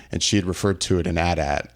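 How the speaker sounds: normal talking voice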